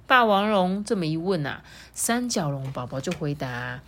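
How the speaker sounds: usual speaking voice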